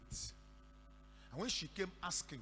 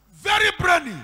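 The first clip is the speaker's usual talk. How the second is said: emphatic exclaim; 13 semitones higher, 23 dB louder than usual. Retold or imitated. Mother praising daughters, retold.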